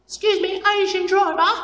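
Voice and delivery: very high, squeaky British call; imitating British hostess. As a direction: mimic a British attendant in falsetto. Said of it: "'Cause she's walking by".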